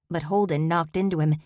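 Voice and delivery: ordinary delivery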